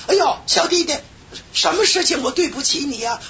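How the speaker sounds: high, puzzled motherly voice; a kind older sister taken aback; lightly voiced surprised big sister; voicing a surprised older woman.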